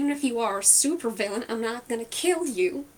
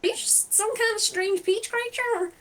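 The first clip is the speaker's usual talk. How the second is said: baffled; 6 semitones higher than usual. Acted. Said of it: "she's just staring like".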